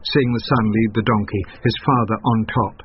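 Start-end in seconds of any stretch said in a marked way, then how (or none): none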